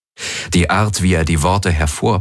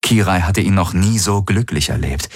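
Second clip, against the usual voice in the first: usual speaking voice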